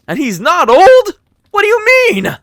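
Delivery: very high, loud disbelief; surprised. Real, not acted. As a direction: squeal it in shocked surprise. Surprised reaction to chat.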